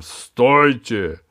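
громовой окрик, громко; крикни «Стойте!» громовым голосом; booming shout. Acted. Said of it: "громовой голос Джина". (громовой голос джинна)

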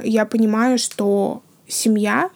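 usual speaking voice

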